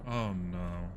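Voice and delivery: ordinary talk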